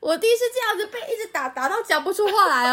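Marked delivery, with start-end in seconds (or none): none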